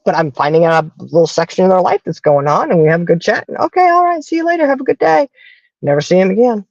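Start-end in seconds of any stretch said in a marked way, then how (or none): 3.6-5.3 high, bright sing-song farewell; cheery goodbye. Retold or imitated. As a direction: chirp a friendly see-you-later way up high.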